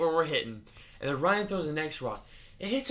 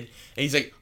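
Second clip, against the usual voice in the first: usual speaking voice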